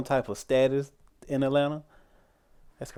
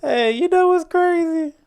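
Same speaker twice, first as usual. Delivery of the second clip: high, slow exclaim; high, drawn-out hey; 6 dB louder than usual. Real, not acted. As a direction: draw it out high in amazement. Sudden outburst.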